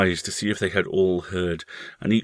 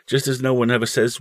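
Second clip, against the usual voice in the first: ordinary delivery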